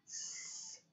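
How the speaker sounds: unvoiced s held as a bare hiss; hissed s sound. Shown as a demonstration. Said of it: "qué sonido o qué letra". (sonido s siseado sin voz)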